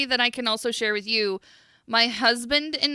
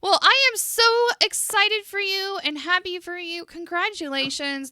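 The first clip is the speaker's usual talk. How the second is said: excited; 9 semitones higher than usual. Real, not acted. Congratulating the engaged guest.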